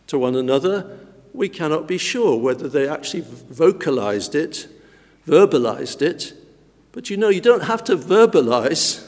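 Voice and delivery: usual speaking voice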